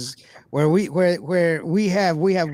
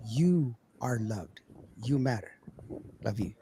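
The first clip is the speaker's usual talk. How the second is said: tender sign-off; 6 semitones lower, 11 dB quieter than usual. Real, not acted. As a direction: hush down to a gentle goodbye. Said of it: "tender sign-off to listeners".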